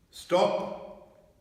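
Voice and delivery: high witch's command, breathy. A narrator's reading. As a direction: halt them sharply as the witch. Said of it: "said the witch".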